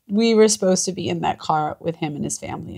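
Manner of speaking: ordinary delivery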